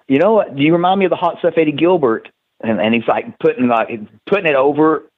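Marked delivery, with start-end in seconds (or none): 0.1-2.3 imitating Paul Heyman. Wrestler retells Heyman's line again, higher and faster. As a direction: rattle it off like Heyman pitching a comparison. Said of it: "as Paul Heyman going".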